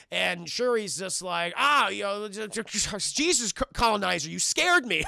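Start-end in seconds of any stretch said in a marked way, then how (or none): 1.6-5.0 louder startled stammering; a hammed-up fright, stuttering; mock startled. Shuri startled by Bilbo.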